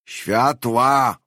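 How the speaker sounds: loud, high shouted order; hoarse cry. A narrator's reading. Unseen man calls for lights as a guest arrives, hoarse shout. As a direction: call it out hoarsely and loudly. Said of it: "wykrzyknął ktoś chrapliwie".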